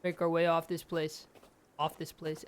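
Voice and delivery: usual speaking voice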